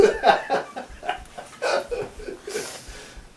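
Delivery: long high-pitched laugh; cracking up. Real, not acted. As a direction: burst out laughing at your own punchline. Cracks up at own punchline.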